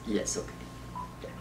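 usual speaking voice

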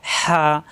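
হা with a strong rasp of air from mid-throat, then a held vowel; hot-air throat sound. Shown as a demonstration. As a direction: squeeze mid-throat and push হা out on warm air. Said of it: "একটু টানা যায়". (গলার মাঝখান থেকে গরম বাতাসে টানা হা)

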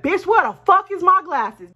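high, loud angry demand; mad outburst. Retold or imitated. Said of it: "I'm mad now".

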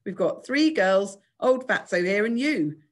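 slow, loud, disdainful list; drawn-out posh sarcasm; sarcastic. A narrator's reading. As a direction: count off useless teammates with scorn. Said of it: "said Giles sarcastically".

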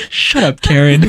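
mock scolding. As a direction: scold the pet, half joking. Pretends scolding annoying dog Karen.

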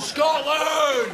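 very high, shouted rallying cry; defiant battle cry. Acted. Second passenger joining in, high defiant scream.

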